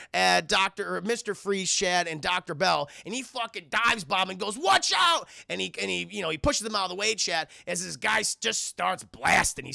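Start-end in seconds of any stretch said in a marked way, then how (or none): 4.6-5.3 loud, high acted yell; sudden high-pitched alarm call; urgent warning shout. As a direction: shout it to save someone.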